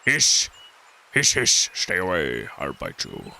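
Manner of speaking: scared snake hissing a threat, yelp then hisses. Pretend. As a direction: hiss out the words like a cornered snake.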